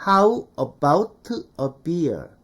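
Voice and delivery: "how about a beer" slow, word by word with a released t; Korean learner's pronunciation. Shown as a demonstration. Teacher showing how we Koreans say it, word by word. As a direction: chop "how about a beer" into full separate words. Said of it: "우리는".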